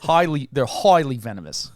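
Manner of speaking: bad Australian accent. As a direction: ham up an Australian documentary voice. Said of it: "my bad Australian accent".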